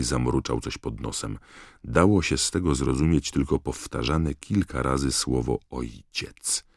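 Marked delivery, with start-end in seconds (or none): none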